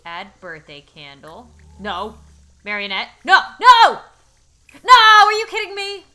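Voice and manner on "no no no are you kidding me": screaming no, much higher and louder; outraged shout. Real, not acted. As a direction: yell in disbelief at the game. Marionette ruins the birthday cake.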